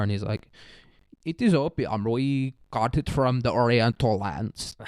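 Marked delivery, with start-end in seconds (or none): 1.2-4.8 put-on European merchant accent. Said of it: "That was my European merchant accent".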